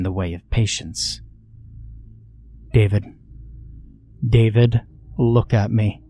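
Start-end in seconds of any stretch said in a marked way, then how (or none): 2.7-6.0 firm, insistent call. Acted. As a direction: hold a firm tone. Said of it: "I held a firm tone".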